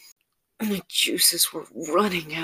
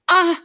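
high, loud gasp of pain; short, loud yelp; sharp pained cry; 13 semitones higher, 12 dB louder than usual. Acted. Narrator acts out being bitten.

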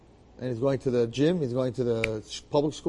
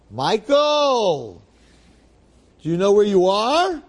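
loud, high, drawn-out call; booming summons; 8 semitones higher, 8 dB louder, much slower than usual. Acted. Heavenly rabbis confronting the newly dead Michael, booming summons. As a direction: call out like a heavenly judge, stretching the name.

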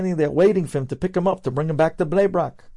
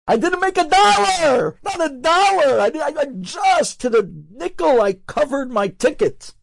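exasperated protest; 11 semitones higher than usual. Retold or imitated. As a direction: pitch it up in frustrated disbelief. Rabbi protests to the Chazon Ish.

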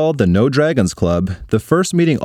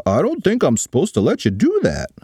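hesitant principal voice, a touch higher; nervous principal; 5 semitones higher than usual. Acted. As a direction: stammer it like a flustered principal. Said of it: "said the principal".

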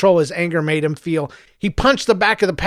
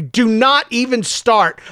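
slow, higher, each word a warning; loud and angry; 6 semitones higher, slower than usual. Acted. Mother silencing her son, slow and angry. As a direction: shut it down, word by word. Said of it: "Her voice was loud and angry".

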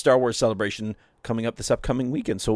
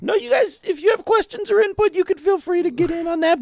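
mock. Mock address to listeners.

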